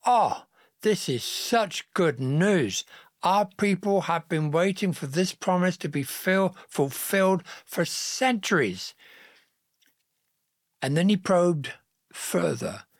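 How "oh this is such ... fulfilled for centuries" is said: higher-pitched, delighted exclamation; excited wonder. A narrator's reading. Joseph hearing the Messiah is coming, excited and awed. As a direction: marvel at long-awaited good news.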